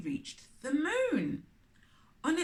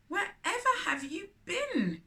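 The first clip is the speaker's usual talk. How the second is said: surprised question; 10 semitones higher than usual. A narrator's reading. Big Joe asks worried.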